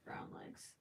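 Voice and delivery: a soft whispered brown legs; whispered to herself. Real, not acted. Deciding the leg colour, whispered to herself. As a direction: murmur it to yourself.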